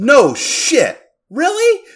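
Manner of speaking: loud, high-pitched disbelief; real amazement. Real, not acted. Podcaster learning who voiced the merchant, amazed. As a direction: exclaim it, floored by the news. Learns the fan theory is true.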